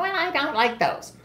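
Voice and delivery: put-on high fussy old mother voice; imitating mom. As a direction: whine it like a picky mom. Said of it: "she would turn around and go".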